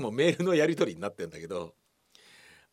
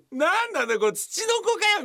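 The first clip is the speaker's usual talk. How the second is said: very high, louder incredulous squeal; shrill mock outrage; 4 dB louder than usual. Shrill mock outrage at the actor's photo.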